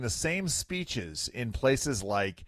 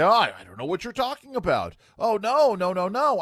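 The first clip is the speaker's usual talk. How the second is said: higher, mocking show of denial; Karine Jean-Pierre; 7 semitones higher, 5 dB louder than usual. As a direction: mimic a press secretary brushing off the question. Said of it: "Karine Jean-Pierre can do".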